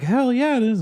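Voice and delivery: high hyped-up boast; hammed-up anime confidence; excited. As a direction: proudly shout it back. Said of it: "he's like".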